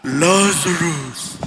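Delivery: "Lazarus." drawn out very low and slow; a deep, heavenly voice calling "Lazarus."; booming archangel. Retold or imitated. The archangel calling the dead from heaven, deep and slow. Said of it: "imagine yung lakas na yun, archangel".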